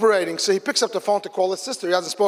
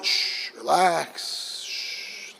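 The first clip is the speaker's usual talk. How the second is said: slow, soft shushing; calming hush; 3 semitones lower, 4 dB quieter, much slower than usual. Retold or imitated. Mother at the stretcher hushes her weak daughter, soft and slow. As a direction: hush her gently, slowing right down. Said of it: "calms me down".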